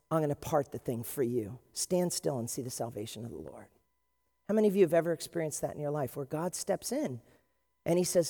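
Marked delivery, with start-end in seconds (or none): 0.1-3.7 lower, softer, calm promise; reassuring Jesus. Acted. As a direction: speak it like a gentle savior.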